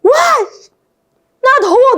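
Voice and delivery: high, loud retold cry of shock; startled outburst. Teacher re-enacting stepping into tropical heat, startled outburst. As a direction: blurt it out as if caught off guard. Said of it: "나도 모르게 너무 놀라가지고".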